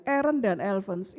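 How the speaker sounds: ordinary talk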